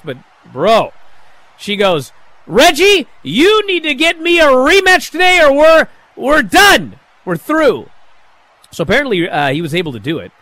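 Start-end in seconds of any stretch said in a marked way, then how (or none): 2.5-7.9 higher, louder ultimatum, half shouted; angry demand. Retold or imitated. Dana ordering her husband Reggie to get a rematch, mock-furious shout.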